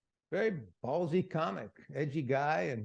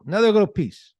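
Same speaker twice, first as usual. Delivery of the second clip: much louder, higher booming; mock bellow; 6 semitones higher, 15 dB louder than usual. Real, not acted. Host jokingly bellowing the clip intro. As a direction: blast it out for fun.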